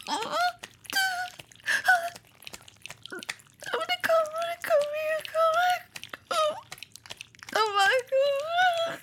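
very high, straining cries of I wanna come; climactic cries. Acted. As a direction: let the voice climb as high as it goes.

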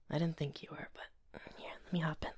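soft bedtime whisper. Acted. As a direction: hush it like a partner in bed. Whispering in bed.

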